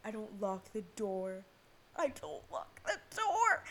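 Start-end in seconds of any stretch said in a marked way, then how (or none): 2.0-3.6 slow, high-pitched squawk of disbelief; incredulous, rising to a loud shriek; exasperated high yell. Real, not acted. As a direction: yell it slowly, voice jumping way up. Repeats it in loud dismay.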